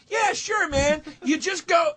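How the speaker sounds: helpful stranger. Retold or imitated. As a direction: play the obliging local in a high voice. Acts obliging stranger giving directions.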